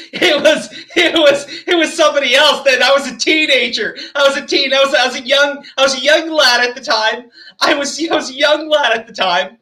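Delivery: mock-pleading, stumbling over the excuse. Mocking the man's excuse, acted out.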